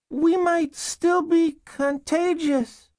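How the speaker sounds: put-on very high, slow little-girl voice; young girl. Girl answering caseworkers through the door, young child voice. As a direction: answer in a tiny child's pitch, drawn out.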